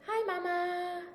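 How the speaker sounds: high little baby voice imitating her daughter. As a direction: coo it like a toddler.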